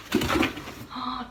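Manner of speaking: sharp gasp of surprise; delighted gasp. Real, not acted. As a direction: gasp on discovering a present. Reacts to surprise book gift.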